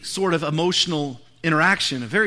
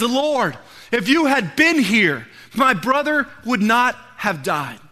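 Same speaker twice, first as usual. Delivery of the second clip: raised, loud, drawn-out appeal; retold pleading reproach; 3 semitones higher, 7 dB louder, slower than usual. Martha to Jesus about her dead brother, loud retold reproach. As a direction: press the words out slowly and forcefully.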